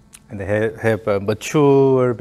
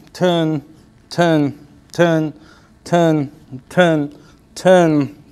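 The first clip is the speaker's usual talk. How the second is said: higher, steady beat of one-word cues; rhythmic coaching calls; 4 semitones higher, much slower than usual. Shown as a demonstration. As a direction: keep time with each turn and push. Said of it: "Emphasize the push".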